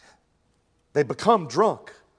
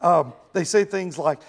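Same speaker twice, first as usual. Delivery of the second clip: ordinary talk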